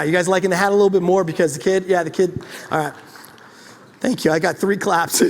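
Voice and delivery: normal talking voice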